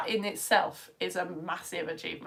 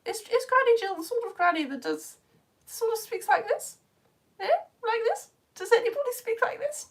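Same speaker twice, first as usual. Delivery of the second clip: high-pitched posh granny voice; mock-posh old granny, very high; 12 semitones higher than usual. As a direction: put on an exaggerated posh accent, much higher. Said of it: "very posh?".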